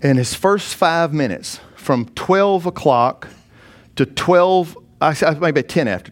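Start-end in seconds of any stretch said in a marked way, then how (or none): 0.0-4.8 slow, drawn out. Acted. Preacher drawing out the time.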